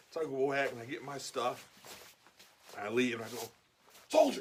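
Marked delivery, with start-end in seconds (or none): 4.1-4.4 high, loud one-word bark; sergeant's call. Retold or imitated. Sergeant hailing the old soldier on leaving, loud commanding call. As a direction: call him to attention.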